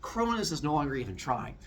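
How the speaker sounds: normal talking voice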